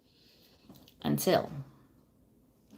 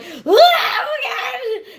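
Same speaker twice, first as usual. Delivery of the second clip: high, slow, shrieking panic with a laughing sob; panicked scream; 6 dB louder, much slower than usual. Retold or imitated. Googling during a panic attack, shrieking high and slow. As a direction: cry out in terror, half laughing.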